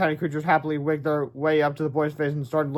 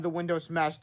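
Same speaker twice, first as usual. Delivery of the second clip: plain reading voice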